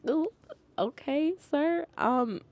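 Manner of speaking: high, hesitant little okay; acted awkward retreat from an angry customer; taken aback. Taken aback by yelling grandpa.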